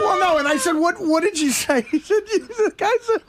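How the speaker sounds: real laughter pushing the voice high; helpless high-pitched laughing speech; cracking up. Real, not acted. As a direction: struggle to speak through laughter. Cracking up retelling a prank call.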